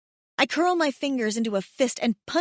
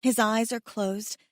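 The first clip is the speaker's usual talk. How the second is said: ordinary delivery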